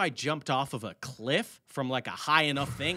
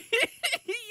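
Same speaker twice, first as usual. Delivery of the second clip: shrieking laugh; high burst of laughter. Real, not acted. Breaks up laughing after ad.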